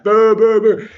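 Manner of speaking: imitated jeering crowd; crowd booing. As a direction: boo like an angry crowd. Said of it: "the crowd's going insane".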